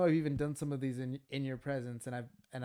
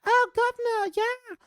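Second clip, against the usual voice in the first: very high, loud hammed-up Cockney; over-the-top terrible Cockney impression; hard Cockney accent; 12 dB louder, slower than usual. Shown as a demonstration. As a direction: shriek it out in bad Cockney. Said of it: "had a hard Cockney accent".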